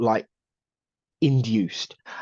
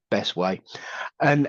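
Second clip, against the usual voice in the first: usual speaking voice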